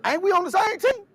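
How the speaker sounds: offended followers pushing back; indignant disciples. Retold or imitated. Disciples voiced in retold story.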